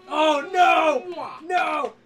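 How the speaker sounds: loud wailing cry; mock despair shout. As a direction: wail for the fallen captain. Crit on their captain.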